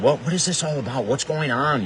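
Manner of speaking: quick, high, baffled self-quote; bewildered. Retold or imitated. Reliving seeing a figure in the dark, fast and baffled. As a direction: replay the confusion in a rush. Retelling his own bewildered reaction.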